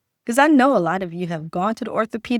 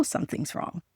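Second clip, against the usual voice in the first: whispered; 6 semitones lower, 12 dB quieter than usual. Real, not acted. Dropped to whisper.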